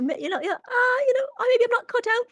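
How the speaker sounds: squeaky high-pitched and fast. Real, not acted. Laughing self-mockery about evil run.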